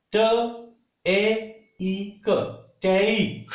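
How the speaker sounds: T, A, E, K sound by sound, then Take joined; sounded-out diphthong. Shown as a demonstration. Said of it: "In this way we pronounce it".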